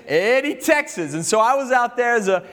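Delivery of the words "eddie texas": country accent. Shown as a demonstration. Pastor proudly names his old town, country accent. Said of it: "you gotta say it with that country accent".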